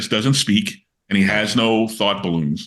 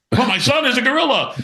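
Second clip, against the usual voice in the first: much higher, hammed-up dismay; melodramatic Superman. Superman finds his son is a gorilla, mock melodramatic wail. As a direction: wail it like a corny comic hero. Superman quoting sixties comic cover.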